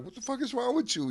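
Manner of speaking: very high-pitched disbelief; retold bewilderment. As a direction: act out baffled squeak at a friend. He re-enacts bewildered question to Dilla.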